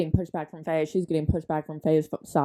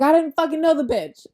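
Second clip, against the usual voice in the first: much higher, louder furious quote; mock crash out; 9 semitones higher, 6 dB louder than usual. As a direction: blow up like she's lost it. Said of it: "she crashes out".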